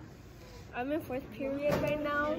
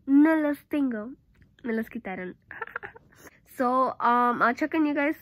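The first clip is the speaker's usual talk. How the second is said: speaking through covered mouth; muffled behind palm; hand over mouth; 5 dB louder than usual. Real, not acted. Seen 0: hand fully covers mouth throughout labelled speech, also before and after.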